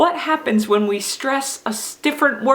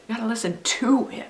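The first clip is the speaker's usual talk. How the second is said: softer line lifting "to"; stress on TO; 5 dB quieter, faster than usual. Shown as a demonstration. Teacher urging children on Jesus's teaching, stress on "to". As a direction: punch "to" before "him". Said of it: "sentence could be heard and understood".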